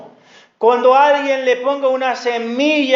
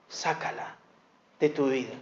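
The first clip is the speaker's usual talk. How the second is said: whispered urging; whispered sácala; 7 semitones lower, 12 dB quieter than usual. Acted. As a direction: breathe it out as a command. Intimate urging within sermon.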